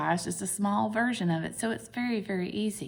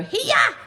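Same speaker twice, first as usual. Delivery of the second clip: much higher, louder karate shout; karate yell; 6 dB louder than usual. Acted. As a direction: chop the air with a playful kiai. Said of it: "and then you go".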